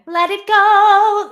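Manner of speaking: high, slow, loud bright Anna singing. Shown as a demonstration. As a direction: sing it like excitable Anna, up high. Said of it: "if Anna was to sing [name], you'd get".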